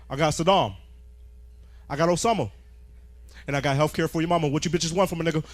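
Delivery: comic imitating Obama boasting to reporters; low, slow Obama-style voice. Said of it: "And he'd be like".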